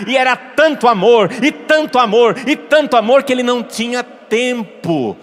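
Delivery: loud, high, rousing crescendo; fired-up preacher at full force; impassioned preaching. Real, not acted. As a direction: build fervently with each repetition. Preacher's climax on Padre Pio's love.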